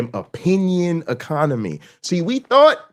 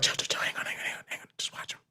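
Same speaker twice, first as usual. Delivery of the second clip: whisper; 15 dB quieter, faster than usual. Retold or imitated. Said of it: "And they were like".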